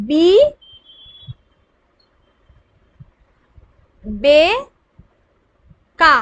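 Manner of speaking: "বি, বে, কা" with very long pauses between; each syllable separate. Shown as a demonstration. Teacher sounding out a word for pupils, "বি, বে, কা" one by one. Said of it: "আলাদা আলাদাই আমরা উচ্চারণ করছি".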